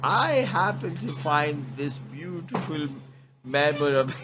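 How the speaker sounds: hammed-up sweet talk to his wife; mock tender. Mock love note to wife.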